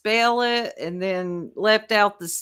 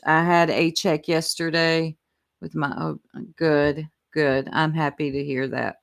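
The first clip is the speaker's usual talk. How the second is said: ordinary talk, nothing marked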